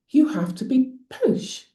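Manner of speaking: high, mock-posh British; posh accent. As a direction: put on a posh English accent. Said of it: "They all sounded posh".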